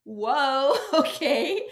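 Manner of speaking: a loud whoa that breaks into a laugh; stunned, then laughs it off; laughing surprise. Retold or imitated. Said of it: "And I'm like".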